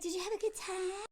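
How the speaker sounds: lifted, soft and caring; sweet concern. Retold or imitated. Girlfriend gently asks her sulking boyfriend, soft sweet concern. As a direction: coax him gently like a worried girlfriend.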